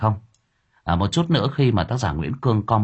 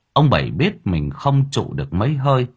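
ordinary delivery

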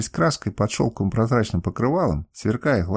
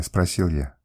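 usual speaking voice

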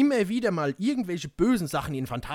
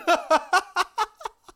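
high, loud villain laugh; hammed-up evil cackle; maniacal laugh; 7 dB louder than usual. Villain laugh after threat.

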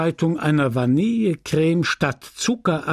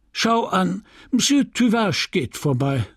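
higher, brightened small talk; forced cheerful remark; 7 semitones higher than usual. A narrator's reading. Apothecary distracting the widower, forced cheer. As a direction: chirp it to break the silence. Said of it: "Charles wiederholte wie eine Maschine".

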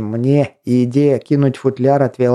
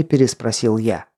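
ordinary delivery, nothing marked

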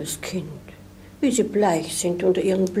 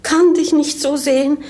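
distressed cry; 5 semitones higher, 4 dB louder than usual. Acted. Die Gouvernante jammert über Lena.

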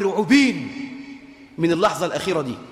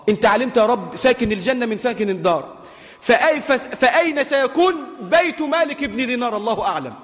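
normal talking voice

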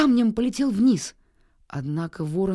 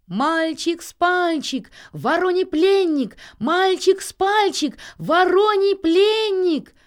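high, loud, slow chant; calling out; 10 semitones higher, 6 dB louder, slower than usual. A narrator's reading. Nils answers whoever asks who is shouting, high drawn-out call. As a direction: shout your answer down, stretching the words. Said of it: "ответил Нильс".

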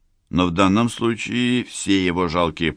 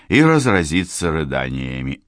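ordinary delivery

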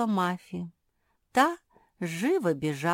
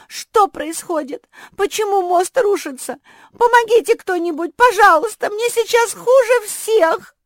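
panicked cry for help; 13 semitones higher, 14 dB louder than usual. A narrator's reading. Collapsing bridge, cries for help.

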